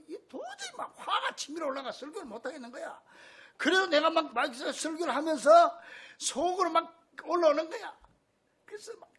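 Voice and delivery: usual speaking voice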